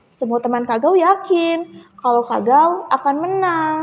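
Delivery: plain reading voice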